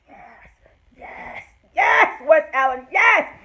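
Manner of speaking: high loud shouted yeses; ecstatic cheering. Retold or imitated. Fan reliving her joy at West Allen, shouting. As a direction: scream with joy. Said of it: "I was like".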